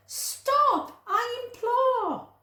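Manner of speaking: a flustered woman at her wits' end; exasperated cry of an old lady. Acted. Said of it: "The little old lady cried".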